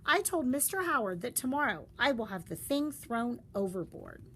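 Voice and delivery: ordinary delivery, nothing marked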